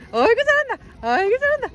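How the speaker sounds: cheering praise. Real, not acted. Praising baby walking.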